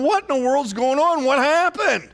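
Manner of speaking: alarmed Joshua. Retold or imitated. Joshua questions returning soldiers.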